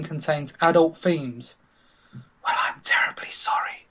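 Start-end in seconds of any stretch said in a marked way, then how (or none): 2.1-3.9 whispered posh lady; breathy upper-class whisper. Acted. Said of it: "she says in her posh voice".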